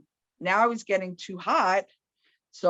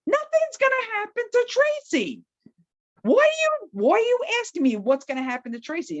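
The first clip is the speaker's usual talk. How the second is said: high, panicky protest, faster at the end; much higher, indignant panic; upset protest. Retold or imitated. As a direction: push back in a rattled, frightened rush. Upset retort to caller.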